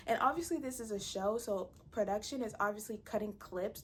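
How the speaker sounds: ordinary delivery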